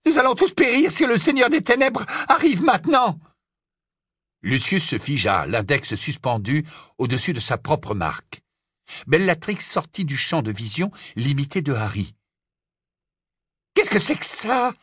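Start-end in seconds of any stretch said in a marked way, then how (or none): 0.0-3.2 very high, frantic warning; panicked shrieking. Acted. Witch warns they will all perish, panicked. Bellatrix panics, stops Lucius. 13.7-14.7 high, startled demand; alarmed question. Acted. Witch suddenly spots something alarming, startled.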